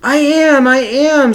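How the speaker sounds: high loud cry of I am; silent shout. Acted. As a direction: shout it out though no one hears. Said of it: "Sylvester wanted to shout".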